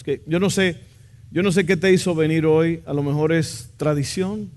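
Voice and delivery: normal talking voice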